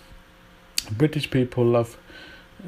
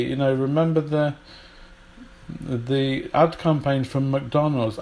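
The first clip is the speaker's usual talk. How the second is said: ordinary talk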